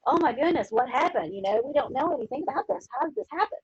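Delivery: high, swooping mock astonishment; feigned shock. Guilty couple hearing that the king is dead, feigned shock. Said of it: "be shocked like everybody else".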